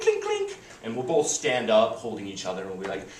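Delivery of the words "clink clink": high-pitched imitation of a tapped glass; clinking glass. Host taps glass before dinner speech, high clinking. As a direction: ring out little glass clinks. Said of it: "I'll do a little clink".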